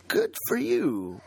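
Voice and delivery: high, slow, unimpressed; a little sarcastic; dry slow reply. Retold or imitated. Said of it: "you can't help but say".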